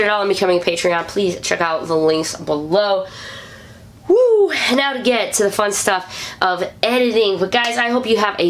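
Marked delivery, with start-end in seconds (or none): 4.1-4.5 a loud high whoop; high loud woo. Real, not acted. Host cheering at the episode's end, high loud whoop. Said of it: "celebrating end of show".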